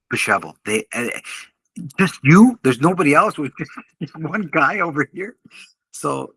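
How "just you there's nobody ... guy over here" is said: disbelieving cops. Retold or imitated.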